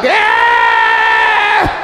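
short, high, loud fake yelp; high mock scream. Man shocked at his own body, yelping high. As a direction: yelp like something just fell off. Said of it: "your dick just fall like".

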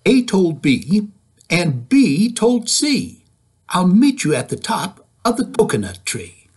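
put-on lively, sing-song read-aloud for kids; reading to children. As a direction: read it bouncy, like storytime. Reading picture book aloud.

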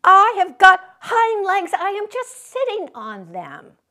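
high, loud protesting cry. A narrator's reading. Little rabbit crying out against the taunt, high and loud. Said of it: "cried the little rabbit".

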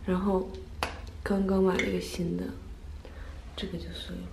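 soft, deflated sigh of disappointment; heartbroken. Real, not acted. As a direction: mourn the broken compact quietly. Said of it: "我真的好伤心".

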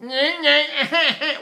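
high, squeezed "nyeh" bleat; mock focused baby whine. Shown as a demonstration. Teacher mocks focused crying, high squeezed whine. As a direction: imitate a baby whining with focused tone. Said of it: "do babies focus? Do they go".

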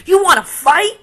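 high, loud taunt with a rising end; bully's dare. Acted. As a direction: dare him to fight. Grouchy ladybug picks fight.

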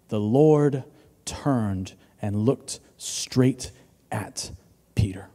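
very slow, low, fading to a hush; slow reading. Real, not acted. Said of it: "I'm gonna read it slowly".

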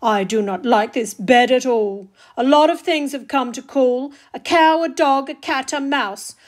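loud, exasperated complaining; a put-upon kid fed up with visitors; grumbling Ned. Acted. Ned complains about his bed.

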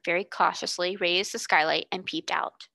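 usual speaking voice

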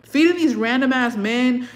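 high, loud, outraged yell at mom; furious rant. Retold or imitated. Daughter yelling at her mom about food stamps, furious. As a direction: blow up at her mother, pitch up and loud. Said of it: "And I was so mad".

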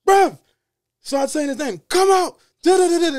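loud yelling of his name. Retold or imitated. Yelling at the door for the man to come out. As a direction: yell it up at the flats. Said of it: "I just start yelling".

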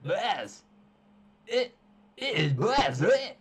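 loud, high, hammed-up Scottish; Scottish caricature, swooping up high; terrible Scottish impression. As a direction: overdo a bad Scottish accent. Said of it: "It's the worst Scottish impression ever".

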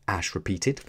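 ordinary delivery, nothing marked

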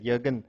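Jürgen with a y sound at the start; German pronunciation. Shown as a demonstration. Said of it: "That's a German way of pronouncing it".

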